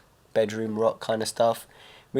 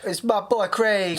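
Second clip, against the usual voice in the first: higher, slow, drawn-out MC shout-out; hip-hop MC voice; 9 semitones higher, slower than usual. Pretend. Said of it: "early two thousands hip-hop, like Ja Rule".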